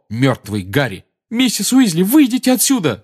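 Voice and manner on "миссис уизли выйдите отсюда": high, loud urgent shout; Harry shouting at Mrs Weasley to leave. A narrator's reading. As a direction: yell at her to get out. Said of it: "закричал Гарри".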